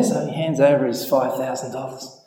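normal talking voice

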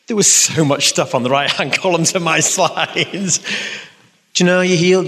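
joking, with a laugh in the middle; amused laughing reply. Real, not acted. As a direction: break into laughter mid-sentence. Joking reply to read question.

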